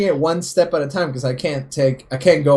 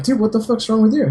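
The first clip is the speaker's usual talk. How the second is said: higher, quick incredulous challenge; mock-indignant, pitched up and hurried; retold confrontation; 7 semitones higher, faster than usual. Telling off his best friend mid-scuffle, incredulous.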